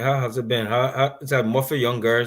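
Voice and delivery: ordinary delivery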